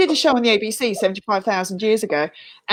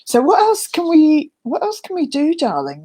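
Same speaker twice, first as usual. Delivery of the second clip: mock theatrical plea; 6 semitones higher than usual.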